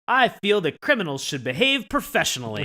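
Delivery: loud indignant yell. Retold or imitated. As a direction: bellow it out in outrage. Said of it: "He just yells it at his butler".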